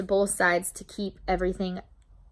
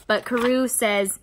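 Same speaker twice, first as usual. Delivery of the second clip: normal talking voice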